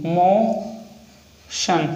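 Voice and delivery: "मोशन" stretched very slowly, "शन" held; drawn-out word. Shown as a demonstration. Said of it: "next example है मोशन". ("मोशन" बहुत धीरे खींचकर)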